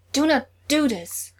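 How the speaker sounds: loud, slow pleading shout; desperate shout. Acted. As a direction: beg him at full voice. Thor pleading with dying brother.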